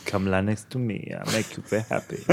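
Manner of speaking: soft, sultry come-on, teasing; nighttime voice. Pretend. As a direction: purr it like a hammed-up seducer. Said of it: "Stop using your nighttime voice".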